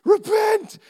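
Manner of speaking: high, loud single-word rebuke; mock stern command. Preacher mock-rebuking a gym-goer, high and loud. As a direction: bark it like a fire-and-brimstone preacher. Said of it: "I'm gonna say".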